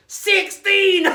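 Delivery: loud, high excited shout; gleeful shout of the number; excited exclaim. Acted. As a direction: burst out with delight.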